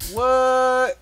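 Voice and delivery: long loud cry of disbelief; mock shock. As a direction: exclaim it in exaggerated disbelief. Mock shock at the late hour.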